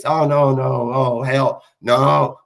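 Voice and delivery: low, drawn-out mock reluctance; mocking imitation. Mocks how they avoid confronting him.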